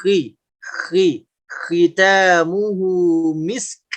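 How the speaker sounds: slow "khitamuhu misk" with rasped kh, unrounded i; moderately thick kha. Shown as a demonstration. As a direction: draw out "khi", neither too thick nor too thin. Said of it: "Yang tepat". ("khi" dengan kha tebal sedang)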